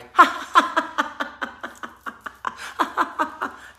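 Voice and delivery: high, loud cackling laugh; mock group laughter. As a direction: burst into an imitated fit of laughter. Mimics the laughing meditation.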